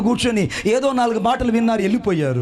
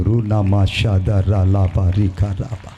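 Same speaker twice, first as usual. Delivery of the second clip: slow, lower chant of unknown syllables; speaking in tongues; 13 semitones lower, much slower than usual. Retold or imitated. Preacher reenacting the gift of tongues, slow low chant.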